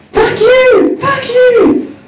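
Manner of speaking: high-pitched mock kid scream; acted high shriek of a cursing toddler. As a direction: imitate a small child yelling in a high voice. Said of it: "standing there screaming at his mum".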